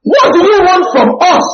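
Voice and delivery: high-pitched demon's outcry; crying out. Acted. Pastor echoing the sister's reading as the demon, crying out. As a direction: cry it out high and forceful. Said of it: "When he says, "Cried out".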